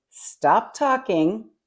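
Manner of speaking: emphatic command. Acted. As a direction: deliver it as a firm order, drawn out. Voices the verse as a command.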